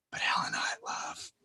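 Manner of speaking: fast breathless whisper; whispered confession. Acted. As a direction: confide it hurriedly. Lovestruck confession.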